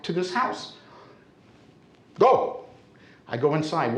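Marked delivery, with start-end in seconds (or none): 2.2-2.6 high, one-word order; acted curt command; bossy neighbour. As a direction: imitate a neighbour sending him inside. Neighbour orders him into the house.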